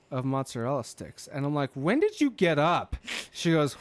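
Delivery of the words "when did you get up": surprised question. Retold or imitated.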